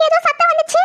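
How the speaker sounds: high-pitched frightened self-talk; spooked, urge yourself to flee; acted scare, high voice; panicky. Alone, hears noise, resolves to flee.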